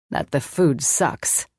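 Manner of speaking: low, slow, amused murmur; smile in his voice. Acted. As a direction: say it grinning, sharing a private joke. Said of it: "He had a smile in his voice".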